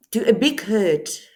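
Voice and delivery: normal talking voice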